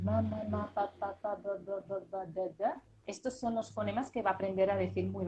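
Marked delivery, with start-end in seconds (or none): 0.0-2.6 lightly imitated baby babbling; mild baby babble. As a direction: babble like an infant. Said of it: "los bebés, qué hacen".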